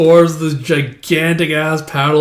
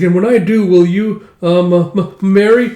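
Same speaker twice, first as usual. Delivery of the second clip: hesitant, higher, halting words; stammering proposal; 4 semitones higher, 3 dB louder than usual. Acted. Young warrior asking Ylva to marry him, stammering. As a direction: stumble nervously up to the big question.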